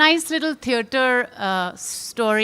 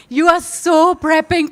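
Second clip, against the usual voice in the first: ordinary talk, nothing marked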